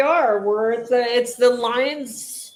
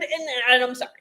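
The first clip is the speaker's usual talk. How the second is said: ordinary talk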